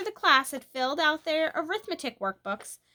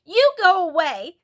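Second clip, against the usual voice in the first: loud, angry snap of you go away; rude go away; 6 semitones higher, 10 dB louder than usual. A narrator's reading. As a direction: push her off sharply. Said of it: "not one bit grateful".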